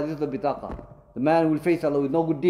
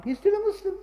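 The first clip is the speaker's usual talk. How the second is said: much higher, ringing emphasis on still; emphatic high conclusion. Real, not acted. Teacher repeating the ruling to the class, high and emphatic. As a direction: lift the whole line high to drive the point home.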